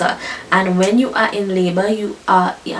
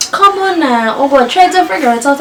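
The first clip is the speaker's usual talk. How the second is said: a higher, louder nurse coaxing her along; urging nurse; 5 semitones higher, 5 dB louder than usual. Retold or imitated. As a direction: play the nurse pushing her to guess, pitched up.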